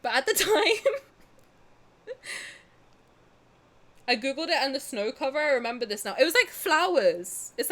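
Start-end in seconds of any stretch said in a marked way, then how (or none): 0.0-2.1 laughing. Real, not acted. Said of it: "Bro, she was aggro".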